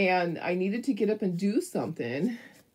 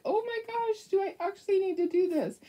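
much higher, alarmed and doubtful; panicky disbelief; 13 semitones higher than usual. Retold or imitated. Dog owner reading peroxide instructions, in alarmed disbelief. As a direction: fret over giving the dog peroxide. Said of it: "And I was like".

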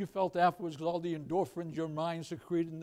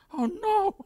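high-pitched imitated wife in dread; mock fear; 7 dB louder than usual. Wife touching snake under pillow, mock fear. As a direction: voice the wife's alarm in falsetto.

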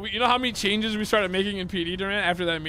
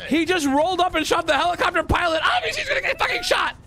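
high, heated yelling; shouted, exasperated rant rising in pitch; outscreaming; 7 semitones higher, 3 dB louder than usual. Pretend. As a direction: yell louder as the argument builds. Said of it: "you're trying to out scream me".

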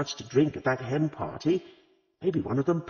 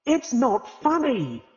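high, loud and slow protest; indignant howl; 12 semitones higher, 5 dB louder, slower than usual. A narrator's reading. Agatha protests to the laughing vicar's wife with a howl. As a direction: wail it like a wounded pride. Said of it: "howled Agatha".

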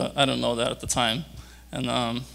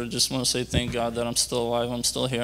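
ordinary delivery

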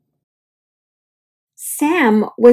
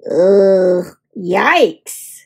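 disgusted; 5 semitones lower than usual. Acted. Kid disgusted.

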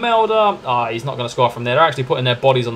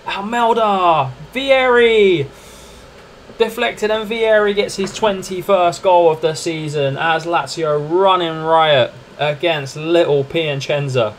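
higher, drawn-out broadcast call; commentator voice; 3 semitones higher, slower than usual. Acted. Gamer commentating his Lazio match like TV. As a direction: play the pundit calling the goal.

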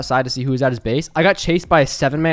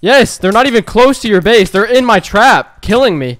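loud, high frustration; exasperated plea; 5 semitones higher, 6 dB louder than usual. Real, not acted. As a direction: urgently insist you need help. Calls ally for help.

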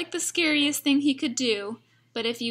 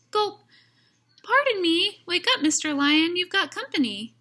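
much higher, timid little mouse; squeaky mouse voice; 6 semitones higher, 3 dB louder than usual. Acted. Mouse nervously waking the lion, high and squeaky. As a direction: squeak it out nervously, small and polite.

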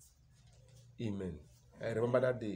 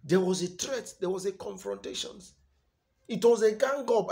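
high-pitched sermon delivery; raised, insistent preaching; preaching with force; 10 semitones higher, 8 dB louder than usual. Real, not acted. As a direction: build it up like a pulpit charge.

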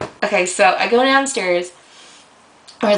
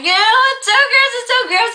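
very high, loud disgusted shriek; screaming in disgust; 4 dB louder than usual. Retold or imitated. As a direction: shriek it at the bug. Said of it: "And I was, like, screaming".

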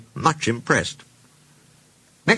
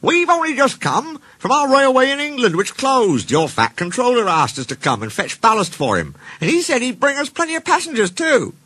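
high, excitable little-engine voice; small engines, eager; 14 semitones higher than usual. Acted. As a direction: pour out the news in a high, small voice.

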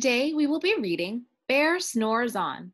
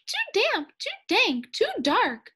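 much higher squeaky mouse voice; 12 semitones higher, 5 dB louder than usual. Acted. Mouse complaining about the damp cave, squeaky and high. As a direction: squeak like a tiny fussy mouse. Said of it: "Mouse squeaks".

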